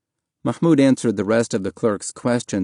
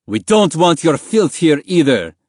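loud, higher-pitched guard's yell; angry yell; 6 semitones higher, 5 dB louder than usual. A narrator's reading. Guard berating a beaten refugee, yelling. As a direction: yell it with contempt at a prisoner. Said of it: "the guard yelled in Arabic".

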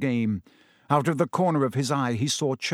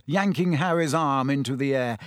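ordinary delivery, nothing marked

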